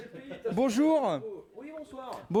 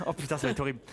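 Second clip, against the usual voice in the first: ordinary talk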